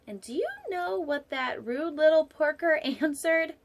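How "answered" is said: plain reading voice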